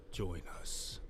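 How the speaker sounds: low ghostly whisper of a haunted house; lightly voiced spooky house calling; creepy house voice. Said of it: "The house says".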